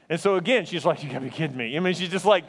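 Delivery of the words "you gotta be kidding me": muttered disbelief. Retold or imitated. Missionary in disbelief.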